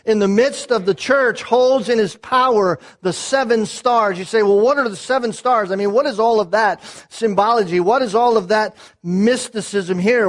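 full-voiced sermon climax; preaching at full force. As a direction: thunder it from the pulpit, building. Preacher building on seven stars.

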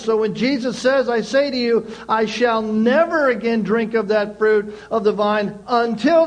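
loud, high, driving preacher voice; full-force preaching. Acted. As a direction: proclaim it to the whole church, full power.

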